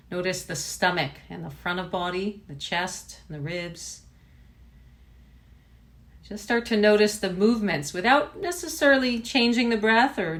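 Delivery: unhurried body-scan voice, long pauses; name each body part, then leave space; guided meditation. Real, not acted. Teacher guiding students to notice body and breath, guided meditation.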